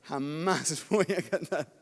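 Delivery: laughing never. Retold or imitated. Laughs at his old refusal.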